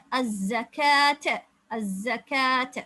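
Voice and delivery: அஸ்-ஜகாத் very slow with a long ா; slow recitation. Shown as a demonstration. Teacher chanting the correct reading for students. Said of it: "உச்சரிக்க வேண்டியது மேலால இருக்கிற அலிஃப்".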